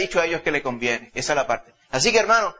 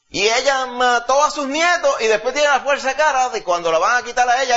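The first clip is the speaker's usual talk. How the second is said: 1 rising indignation; 7 semitones higher, 3 dB louder than usual. Real, not acted. Preacher mocks Atalía's nerve.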